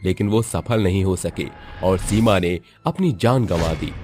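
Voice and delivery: plain reading voice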